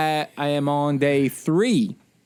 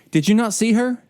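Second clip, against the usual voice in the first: sharp, louder scolding; indignant sergeant scolding, raised; aggressive rebuke; 7 semitones higher, 5 dB louder than usual. Retold or imitated. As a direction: snap at him like an angry sergeant. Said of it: "she points in this aggressive way".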